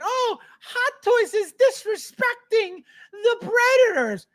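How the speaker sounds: high, slow whiny voice; mock critics whining about Predator figures; mocking complainer. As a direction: parody the complainers' whine.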